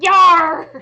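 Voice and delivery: very loud, high pirate yell; pirate crew cheer. Acted. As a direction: bellow it like a whole pirate crew. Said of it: "they're all just like".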